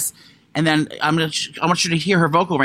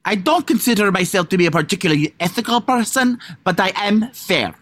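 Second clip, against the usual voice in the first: higher, exaggerated Italian-woman accent; attempted Italian accent; 3 semitones higher than usual. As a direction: imitate a movie trailer's dubious Italian accent. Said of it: "now I'm gonna try to do it".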